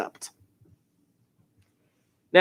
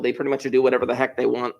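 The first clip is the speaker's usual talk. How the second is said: usual speaking voice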